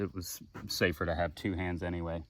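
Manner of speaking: normal talking voice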